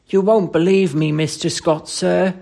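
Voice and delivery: reluctant Cockney protest; Cockney dialect. Acted. Thomas fears his employer's ridicule, protesting Cockney. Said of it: "Thomas' Cockney dialect".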